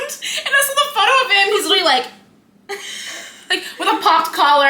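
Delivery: high, loud talk through real laughter; can barely get it out for laughing; laughing about the popped collar photo. Real, not acted. Laughing while describing photo.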